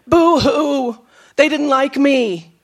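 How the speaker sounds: hammed-up hurt feelings; mock boo-hoo. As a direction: play up a pouty pity party. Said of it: "Most of us fall apart".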